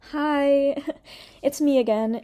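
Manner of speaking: high, cutesy imitated "Hi"; lightly put-on cheerful voice; mock quirky greeting. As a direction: parody a fake-sweet hello. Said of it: "She started it off like".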